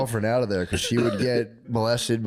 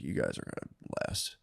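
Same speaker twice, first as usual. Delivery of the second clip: mock whisper; 10 semitones lower, 12 dB quieter than usual. Guy's sarcastic advice.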